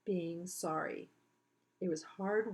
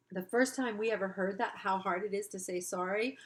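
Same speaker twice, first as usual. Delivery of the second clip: ordinary delivery, nothing marked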